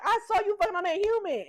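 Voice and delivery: high, loud mock outrage; hammed-up accusation; outraged accusation. Narrator replaying Ralph catching Gemma, mock outrage.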